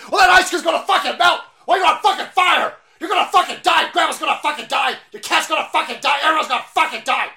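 high loud screaming rant; mock screaming teacher. Mock teacher screaming doom at the children. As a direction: scream it at the class.

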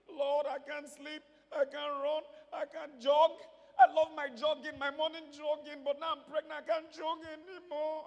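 hammed-up, high-pitched pregnancy complaint; complaining pregnant woman. Acts a complaining pregnant woman praying.

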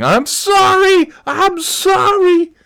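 drawn-out, high-pitched scream of apology; mock screaming. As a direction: imitate a man screaming sorry, slowly stretched. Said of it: "he's just screaming".

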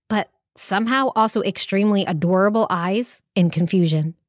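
ordinary delivery, nothing marked

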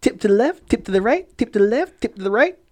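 sway each phrase upward like a rocking canoe; rocking rhythm. Retold or imitated. Storyteller mimics the canoe tipping, rocking rhythm.

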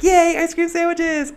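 put-on high-pitched, childlike cheer; gleeful cheer. Replaying the reaction to freezer ice cream, gleeful cheer. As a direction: cheer it high like a delighted kid.

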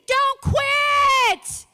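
huge high shout, QUIT drawn out. Acted. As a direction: shout it like a whole crowd cheering from above. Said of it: "is shouting down".

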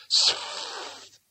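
"Sf" as a long hissed s fading into f; spell pronunciation. A narrator's reading. Bethan offers another guess, "Sf" as a drawn-out hiss. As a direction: draw out "Sf" with no vowel. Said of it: "it should be pronounced".

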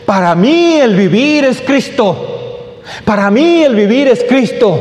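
high, drawn-out declaration of scripture; preacher's proclamation. Real, not acted. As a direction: proclaim the verse slowly to the congregation. Proclaims scripture twice to congregation.

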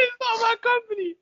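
high squeaky protesting voice. Acted.

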